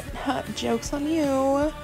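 ordinary talk

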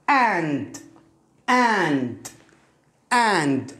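"And" with a long open a, falling; demonstrated pronunciation. Shown as a demonstration. Teacher modelling the word for learners, drawn-out pronunciation. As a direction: draw out the vowel in "And", ending on nd. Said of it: "تنطق ماذا؟".

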